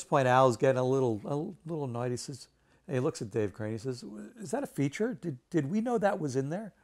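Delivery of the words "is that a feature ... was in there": much higher, incredulous questioning; imitating a puzzled rival asking his colleague; baffled disbelief. Annoyed designer asks colleague.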